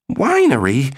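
high-pitched incredulous exclamation; scandalized, high-voiced query; exclaiming. A narrator's reading. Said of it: "Maggie Walsh exclaimed".

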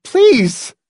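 high, loud plea; imploring cry; begging. A narrator's reading. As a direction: beg him desperately. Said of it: "Marcia begged".

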